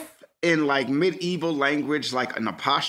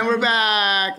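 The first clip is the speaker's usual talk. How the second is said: high, loud, drawn-out announcer call; show-return call; 7 semitones higher, 5 dB louder, slower than usual. Pretend. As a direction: sing out the return from a break. Practising the return from break.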